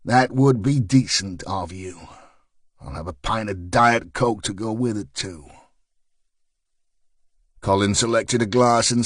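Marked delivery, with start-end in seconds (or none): 0.0-5.5 sarcastic. Acted. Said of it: "with more than a dollop of sarcasm".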